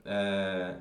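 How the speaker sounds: ordinary talk, nothing marked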